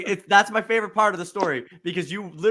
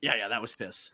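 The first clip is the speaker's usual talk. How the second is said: low mumbled quote; 9 semitones lower, 10 dB quieter than usual. Retold or imitated. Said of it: "You go".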